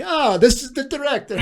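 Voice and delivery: put-on horrible Italian accent. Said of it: "in my horrible Italian accent".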